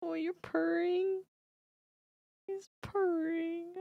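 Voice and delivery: high, slow cooing at a purring cat. Real, not acted. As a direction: pet voice, high and drawn out.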